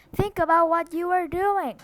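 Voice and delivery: high, loud pleading cry. A narrator's reading. Teacher crying out to the mob, loud. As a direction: cry out to stop them. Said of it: "cried Miss Catherine".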